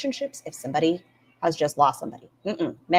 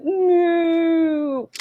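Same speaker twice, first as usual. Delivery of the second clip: mock panicked no; 11 semitones higher, 4 dB louder than usual. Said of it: "So Ambi's like".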